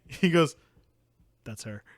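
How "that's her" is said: low, soft, understated remark; deadpan. Retold or imitated. Informant pointing out the princess, quiet deadpan. Character's terse identification, acted.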